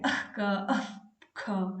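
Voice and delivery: अह before क and ख, breathy and slow; jihvamuliya demo. Shown as a demonstration. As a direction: breathe the half visarga into क and ख. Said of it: "इस प्रकार से इसका उच्चारण होता है". (अह, ख को धीमे और साँस के साथ बोलना)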